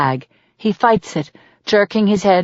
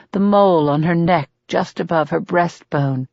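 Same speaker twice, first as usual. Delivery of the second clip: ordinary delivery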